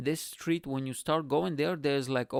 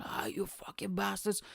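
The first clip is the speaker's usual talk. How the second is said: high, softer mock outburst; imitated angry cursing; 5 semitones higher, 6 dB quieter than usual. Pub local cursing Bulgarian visitors, imitated anger. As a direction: curse them in a put-on voice. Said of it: "they start cursing us".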